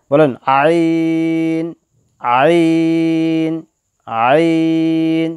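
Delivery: আইন drawn out very slowly, pressed hard from the middle of the throat; Arabic ain said slowly, tight in the throat. Shown as a demonstration. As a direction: squeeze আইন from the throat and stretch it long. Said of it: "আইন হলকের মাঝ হাতে গলা চেপে হবে".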